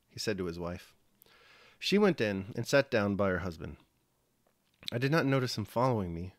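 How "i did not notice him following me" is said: usual speaking voice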